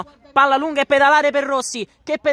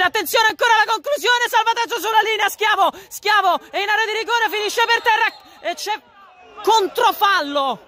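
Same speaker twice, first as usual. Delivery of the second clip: high, urgent goal call; frantic near-miss commentary; breathless italian goal commentary; 5 semitones higher than usual. Real, not acted. Excited live play-by-play climax.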